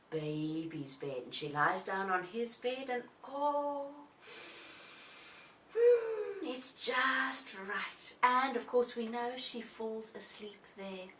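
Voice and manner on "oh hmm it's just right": slow satisfied sigh of approval; content. Acted. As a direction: savour it, very slowly. Goldilocks finds right bed.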